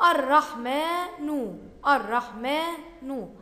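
الرحمن slowly, with a long "aa" after the m; long alif in الرحمن. Shown as a demonstration. Teacher showing pupils a hidden alif, الرحمن slow and stretched. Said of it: "بعد الميم ألف تنطق ولا تكتب".

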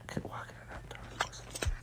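fast, hushed whisper to himself; quick annoyed whisper; whispered frustration. Real, not acted. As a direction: whisper it under your breath, irritated. Muttering to himself mid-fight.

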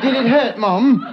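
put-on an overly apologetic English baby; British accent. English baby's first words.

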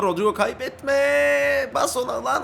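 high, long-held cry; drawn-out plea. Real, not acted. Gamer begging Rodrigo not to lose the ball, drawn-out high plea. Pleads with teammate to keep ball.

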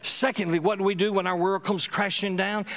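usual speaking voice

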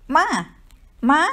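calling out in fear, lightly voiced child; frightened child's call. A narrator's reading. Daughter shaking her mother awake in the dark, frightened call. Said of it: "மகளின் பயந்த நனைந்த குரலின்".

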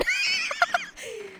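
high giggle burst; laugh. Real, not acted. Laughs after shooting the drone.

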